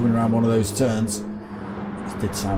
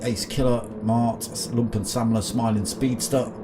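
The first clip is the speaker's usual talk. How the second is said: motorsport commentator; 3 semitones higher than usual. Acted. Live sim race commentary.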